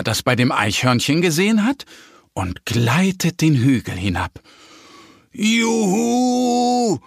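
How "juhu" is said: very high, gleeful cheer; joyful whoop. A narrator's reading. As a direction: cheer as you glide downhill. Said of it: "ruft der kleine Bär". (freudiges Juhu, hoch gerufen)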